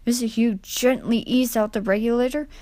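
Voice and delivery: plain reading voice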